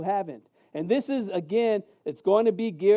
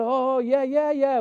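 faking recognition; 5 semitones higher, 4 dB louder, slower than usual. Retold or imitated. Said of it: "I said".

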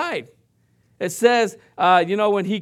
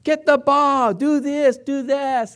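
sideline yell; 5 semitones higher, slower than usual. Retold or imitated. Mom yelling at her daughter from the soccer sidelines, mock yell. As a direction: bark it like a parent at a soccer game. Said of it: "Carmen used to yell from the sidelines".

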